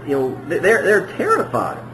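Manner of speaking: ordinary delivery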